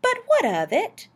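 high, rising question; puzzled challenge. Acted. Wife questioning why the date matters, dismissive. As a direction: ask it high, as if it hardly matters.

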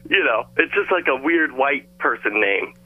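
normal talking voice

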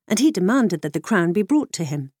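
ordinary delivery, nothing marked

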